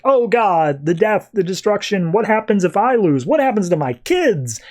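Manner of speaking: high-pitched, retold panic; panicking. Flash fearing for his kids amid the destruction, panicking. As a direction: blurt out mounting worries in mock panic. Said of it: "he starts panicking".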